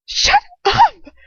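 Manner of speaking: very high, loud scream. A narrator's reading. Girl screams at her captor to shut up. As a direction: scream it at him. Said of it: "I scream".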